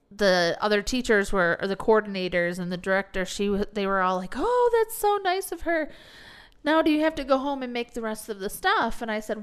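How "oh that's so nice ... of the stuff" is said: high, sugary mock-gush; gushing coworkers. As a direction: mimic the gushing coworkers, pitched up.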